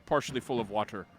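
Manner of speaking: low, measured eerie tale ending; solemn storytelling. A narrator's reading. Bard waking to an abandoned camp and graves, solemn. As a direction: drop low and let the discovery sink in.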